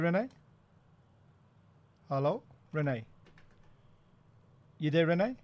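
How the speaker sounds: ordinary delivery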